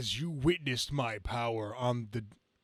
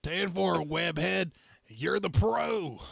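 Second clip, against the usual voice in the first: trucker voice; 6 semitones higher, 5 dB louder, slower than usual. Acted. Trucker character talking on radio.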